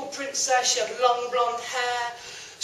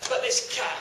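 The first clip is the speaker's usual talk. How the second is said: ordinary delivery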